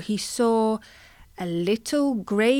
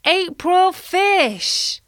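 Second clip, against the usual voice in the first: calling out; 9 semitones higher, 3 dB louder, much slower than usual. Pretend. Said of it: "they might call out".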